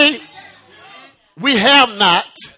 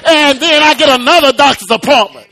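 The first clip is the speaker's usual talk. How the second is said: high, sustained preacher's cadence; full-force preaching; 6 semitones higher than usual. Acted. Preacher testifying that Doctor Jesus reached into your life, impassioned high-pitched preaching. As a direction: stretch the phrases out in impassioned praise.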